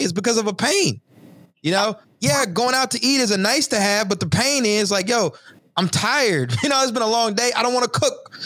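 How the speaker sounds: real enthusiasm breaking through, voice climbing; fired up about the idea; excited. Real, not acted. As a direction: pitch lifted high, words tumbling with energy. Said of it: "I get excited talking about this".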